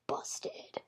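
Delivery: whispered busted; a sneaky whisper to a friend. A narrator's reading. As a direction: breathe it out unvoiced.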